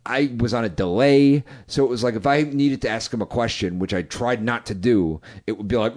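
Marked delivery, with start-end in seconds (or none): none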